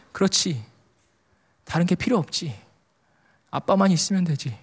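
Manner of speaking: quiet, unhurried reassurance in short phrases; gently affirm, pausing between phrases; Holy Spirit's voice. Retold or imitated. God answering the father's moved heart, softly reassuring. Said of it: "성령님의 음성처럼 들렸어요".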